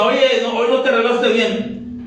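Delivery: high, teasing jab at the wife; mock husband. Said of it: "No a mi esposa". (esposo criticando a la esposa en tono agudo)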